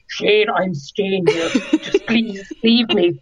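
drawn-out, very slow plea with a laugh; slow pleading. Retold or imitated. Telling Shane to leave, slow laughing plea. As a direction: stretch every word, half laughing. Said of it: "I was like".

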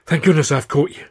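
loud, breathless pleading; out of breath. A narrator's reading. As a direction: pant out assurances after running. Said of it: "trying to haul some warmer air".

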